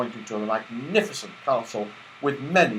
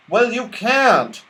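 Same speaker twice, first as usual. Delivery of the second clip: firm, slow and raised; 11 semitones higher, 3 dB louder, slower than usual. Acted. Moonface stopping Joe's somersaults, firm and slow. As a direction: put your foot down slowly. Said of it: "said Moonface firmly".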